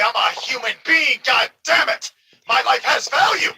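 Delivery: defiant shout. Retold or imitated. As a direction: yell it like you've had enough. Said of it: "You've got to say".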